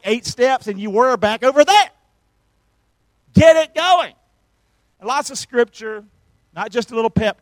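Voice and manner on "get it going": loud exhortation. Acted. Preacher urging congregation.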